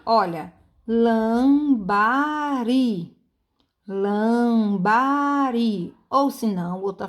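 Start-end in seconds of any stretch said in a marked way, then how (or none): 0.9-3.1 lambari very slow, every syllable stretched; drawn-out syllables. Shown as a demonstration. Teacher showing pupils how to find the strong syllable, drawn-out syllables. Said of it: "ao pronunciar a gente demora mais". 3.9-5.9 lambari extremely slow, LAAM-BAA-RII; drawn-out syllables. Shown as a demonstration. Teacher repeating the word for pupils, very drawn-out syllables. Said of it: "ao pronunciar a gente demora mais".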